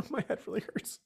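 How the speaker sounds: soft whimper through tears; tearful whimper. Real, not acted. Crying adventurer tells the Furbolg, in a tearful whimper. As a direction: whimper it, barely holding together. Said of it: "I'm really crying".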